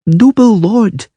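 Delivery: put-on high, slow little girl; shy girl addressing a lord; timid child. A narrator's reading.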